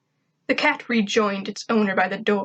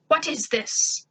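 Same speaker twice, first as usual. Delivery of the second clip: shocked alien voice; 6 semitones higher, slower than usual. A narrator's reading. Zim shocked at Tak's reveal.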